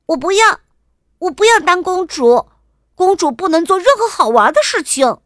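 high, loud princess protest; defiant protest. A narrator's reading. Princess refusing her father's order, loudly defiant.

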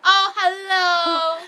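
slow drawn-out high oh hello; exaggerated hello. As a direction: stretch out a hammy greeting. Mock English greeting. (拖长的夸张问候)

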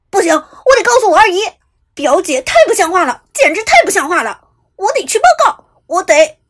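shrieking, high and very loud; hysterical. A narrator's reading. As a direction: hysterical threat to tell on her. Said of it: "男生歇斯底里道". (歇斯底里地尖叫)

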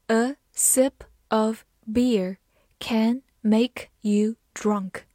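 word by word, with gaps; very slow teaching read, each word separate; slow model reading. Shown as a demonstration. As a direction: read it slowly for learners to follow. Teacher reading the sentence slowly for learners.

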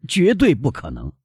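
mildly exclaiming that it cannot be done; innkeeper's cry. A narrator's reading. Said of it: "帕斯特里尼老板喊道". (店老板略带激动地喊)

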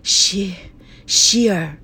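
faint, halting call of a dying grandmother; weak voice. Acted. Bedridden grandmother faintly calls her grandson, weak and halting. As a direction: barely get the name out. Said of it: "嘴里终于发出微弱的声音".

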